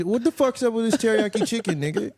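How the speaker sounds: high mock indignation at free chicken; suspicious outrage. Guest mocking himself at Costco samples, high outraged squawk. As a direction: squawk at the sample like it's a trap. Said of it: "I'm like".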